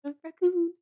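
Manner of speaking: high, loud squeaky little voice. Pretend. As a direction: squeak out the word playfully. Repeating raccoon in high voice.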